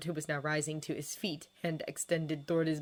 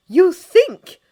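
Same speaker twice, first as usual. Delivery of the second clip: very loud, high sarcastic outburst; shouted, indignant comeback; outraged retort; 13 semitones higher, 20 dB louder than usual. A narrator's reading. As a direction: explode back at him. She retorts to sympathy.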